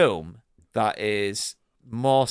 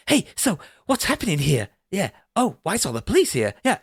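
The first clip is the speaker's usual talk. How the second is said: higher-pitched, obviously suspicious questioning; hammed-up suspicious innocence; suspicious act; 8 semitones higher, 3 dB louder than usual. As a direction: imitate a man badly hiding his guilt. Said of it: "being really suspicious in the way that he talks".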